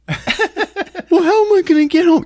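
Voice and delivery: high, loud put-on protest; whiny brother. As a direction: imitate a whining kid brother. Acting dumped brother's protest.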